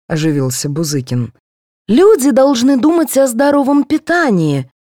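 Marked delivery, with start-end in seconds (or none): 1.9-4.7 high, oratorical pronouncement; preachy. A narrator's reading. Dentist preaching healthy eating to colleagues, oratorical. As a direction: proclaim it to the whole room. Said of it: "вещала стоматолог".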